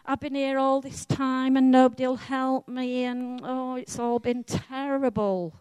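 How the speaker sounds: high, sustained self-pitying moan; whining. Acted. Man at the pool complains nobody helps, whining. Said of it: "He begins to whine about".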